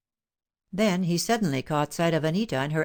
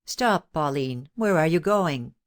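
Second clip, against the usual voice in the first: ordinary delivery, nothing marked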